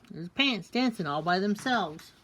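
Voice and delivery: plain reading voice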